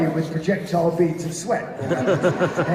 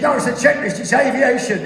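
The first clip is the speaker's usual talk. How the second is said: insistent co-pilot; 5 semitones higher, 6 dB louder than usual. Acted. Acted quarrel over the checklist.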